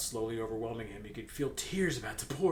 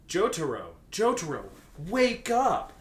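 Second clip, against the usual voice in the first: louder urgent yell, rising high; 5 semitones higher, 6 dB louder, slower than usual. Acted. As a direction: shout to wake the sick friend. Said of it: "Kakyoin yelled".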